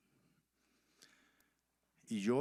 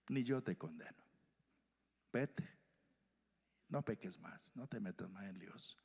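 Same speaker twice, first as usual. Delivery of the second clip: gentle release; 3 semitones lower, 6 dB quieter than usual. Acted. Jesus gently dismisses the woman.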